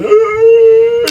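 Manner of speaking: high, loud "ooh" sob; hammed-up high boo-hoo; mock crying wail. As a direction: imitate an ugly crier's wail. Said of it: "when you cry, is it like".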